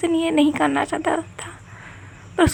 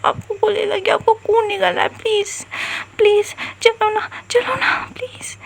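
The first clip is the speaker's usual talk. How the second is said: higher and higher, breaking into a whisper; tearful pleading; 4 semitones higher than usual. Acted. Daughter begging her bleeding mom to go to hospital, tearful. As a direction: beg desperately, ending in a whisper.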